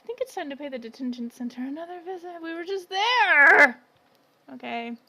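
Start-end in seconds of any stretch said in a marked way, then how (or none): 2.4-3.8 louder, slow, drawn-out complaint; exasperated protest. Real, not acted. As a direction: whine that you've only just left. Complaint about revisiting.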